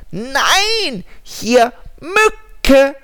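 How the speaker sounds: high, loud squeaky mosquito; tiny mosquito voice. Acted. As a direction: chirp it like a tiny insect. Mosquito answers.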